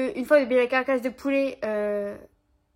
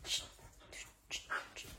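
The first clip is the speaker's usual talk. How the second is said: very soft, voiceless shushes; shushing; 22 dB quieter than usual. Real, not acted. Owner trying to calm her excited dog, shushing softly. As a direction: hush the dog in short repeats.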